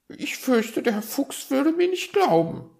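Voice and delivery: very high, loud magpie voice; reply in a high-pitched bird voice; sheepish magpie. Acted. Magpie doubting Herr Fuchs would believe her, sheepish.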